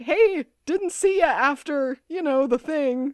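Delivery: high, loud mock-breezy message; casual voicemail voice. Mason leaving a voicemail for Liz, hammed-up casual. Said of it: "Mason is leaving a voicemail".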